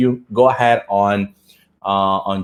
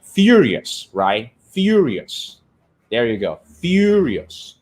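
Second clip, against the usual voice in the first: teacher modelling the word furious; model word; slower than usual. Shown as a demonstration. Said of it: "[name] says".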